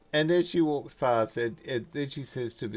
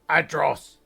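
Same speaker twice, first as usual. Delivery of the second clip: loud, raised first call; 4 semitones higher, 7 dB louder than usual. Acted. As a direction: shout a name up to someone. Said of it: "she yells up".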